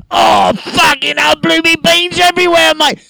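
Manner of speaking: put-on Cockney flatmate, drunk, yelling through the wall. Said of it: "old English Cockney dude".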